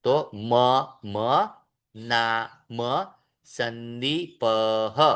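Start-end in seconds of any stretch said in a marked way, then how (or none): 2.0-5.0 "नाम संदीपः" very slow, ending with a breathed "अह"; visarga pronounced. Shown as a demonstration. Teacher demonstrating visarga on "संदीपः" to the class. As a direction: stretch "संदीपः" and let the visarga out as "अह". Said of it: "अब पह के बाद देखिए".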